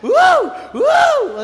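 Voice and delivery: very high, loud whoop of freedom; joyful whoop. Acted. Pastor acts out the joy of being set free, loud high whoop. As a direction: let out a wild happy whoop.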